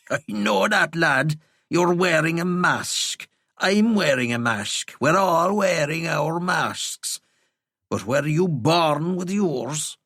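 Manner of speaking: put-on slow, lilting Kerry accent, teasing; an odd old Kerry vagrant, amused and rambling; Kerry brogue, whimsical old man; old Kerryman. A narrator's reading. Nuncle lecturing about masks.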